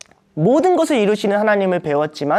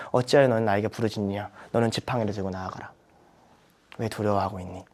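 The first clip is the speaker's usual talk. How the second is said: much lower, softer searching question; gentle divine rebuke; 9 semitones lower, 9 dB quieter than usual. Retold or imitated. God questioning a hesitating believer, quiet and low. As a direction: ask it quietly, like a patient father. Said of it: "모세한테 하는 말을 던져주실".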